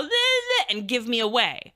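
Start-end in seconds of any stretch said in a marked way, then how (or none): 0.0-0.7 high-pitched mock baby noise; sudden high, silly infant sound; imitated baby babble. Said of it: "the baby was gonna go".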